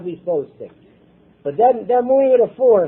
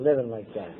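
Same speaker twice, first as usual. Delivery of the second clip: much lower, softer echo; quiet echo; 10 semitones lower, 9 dB quieter than usual. Real, not acted. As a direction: let the repeat fade away. Echo of the previous line.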